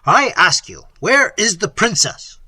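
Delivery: loud, insistent questioning; impatient demand. Acted. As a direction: press them for a straight answer. Prince demands answer from servants.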